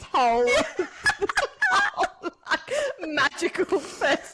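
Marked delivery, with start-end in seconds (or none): 0.5-3.0 squealing burst of laughter; high laugh. Real, not acted. Friend laughing at the ruined Santa visit, squealing.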